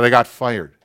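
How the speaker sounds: loud, angry blurt; imitating an angry husband, loud; angry retort. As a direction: throw it back loudly at the wife. Retold angry husband snaps back.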